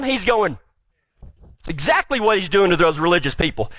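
ordinary talk, nothing marked

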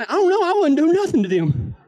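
high, laughing fake innocence; laughing innocent denial. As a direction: laugh it off like you did nothing. Denying he provoked the wasps.

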